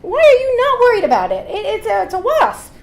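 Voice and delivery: high, loud, frantic disbelief; going mad. Retold or imitated. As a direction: act out a panicked girl losing it. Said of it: "a girl who was going mad".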